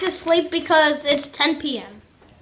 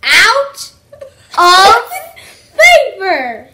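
laughing while talking; 5 dB louder, much slower than usual. Real, not acted. Laughing through the intro.

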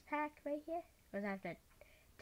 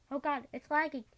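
normal talking voice

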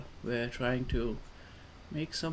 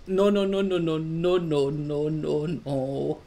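panicked cry; 2 semitones higher, 6 dB louder than usual. Real, not acted. Player watching his climber slip, panicked cry. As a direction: cry out as it all slips away, easing downward. Slipping again in the game.

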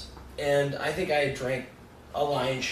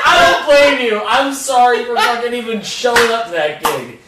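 laughing apology; 8 semitones higher, 11 dB louder than usual. Retold or imitated. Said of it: "I was like".

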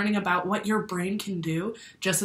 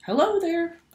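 cheery character greeting; 9 semitones higher, 5 dB louder than usual. Acted. Character greeting in picture book.

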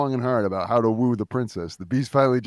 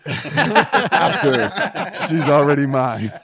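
loud, laughing gloat; laughing villain reveal; 4 semitones higher, 8 dB louder, slower than usual. Acted. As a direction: chuckle through the villain reveal. Beast reveals himself, laughing.

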